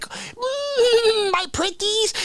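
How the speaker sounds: high cackling witch. Retold or imitated. Aliens greeting the melting astronaut, witchy high. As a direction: purr it like a wicked witch. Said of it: "they're like".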